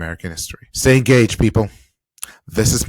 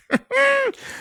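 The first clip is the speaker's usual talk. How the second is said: short high-pitched chuckle; incredulous laugh. Real, not acted. Reacting to people still defending him, incredulous laugh. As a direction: laugh it off in disbelief. Laughs in disbelief at their loyalty.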